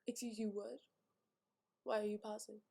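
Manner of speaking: soft, stunned questioning; quietly probing, mock-affronted; hushed suspicion. Sister noticing her brother paused, hushed suspicion.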